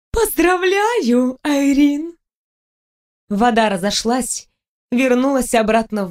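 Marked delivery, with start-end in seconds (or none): 0.1-2.2 высокое, певучее поздравление русалки; поздравь с восторгом, растягивая слова; радостная ундина. A narrator's reading. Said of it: "Ундина радостно захлопала в ладоши". (ликующий голос водяной девы, намного выше обычного)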